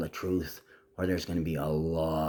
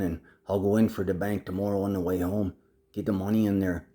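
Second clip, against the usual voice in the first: usual speaking voice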